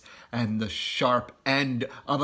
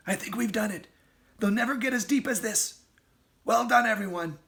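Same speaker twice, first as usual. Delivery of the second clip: relieved Mister Fox; 9 semitones higher, faster than usual. Acted. Fox relieved after escape.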